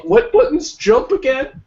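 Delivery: high, slow confused question. Retold or imitated. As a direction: ask it totally lost. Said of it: "I'm just like".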